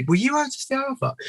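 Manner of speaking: very high, tentative question; mock starstruck asking; imitated young fan. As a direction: ask in a squeaky, awed fan voice. Imitates young runner recognizing him.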